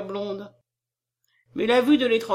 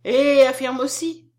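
loud, high, slow musing; raised-voice self-talk; thinking aloud; 4 semitones higher, 8 dB louder, slower than usual. A narrator's reading. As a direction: wonder out loud, drawing it out. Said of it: "pensa tout haut Madeleine".

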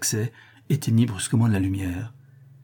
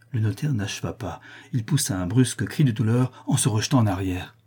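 plain reading voice

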